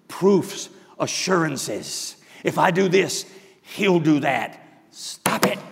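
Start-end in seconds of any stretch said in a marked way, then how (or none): 4.9-5.6 "Stop it" breathed as a toneless whisper; "Stop it" whispered, unvoiced; whispered command. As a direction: drop "Stop it" to a hushed whisper. Preacher repeats command softly.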